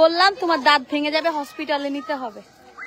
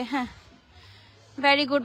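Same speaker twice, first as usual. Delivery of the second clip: ordinary talk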